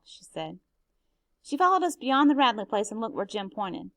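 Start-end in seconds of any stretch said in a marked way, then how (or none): none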